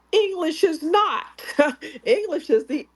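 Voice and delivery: high-pitched, breaking into a laugh; amused emphasis. Real, not acted. Explaining linguistic isolates to the group, laughing and emphatic. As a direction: insist on it, laughing between phrases.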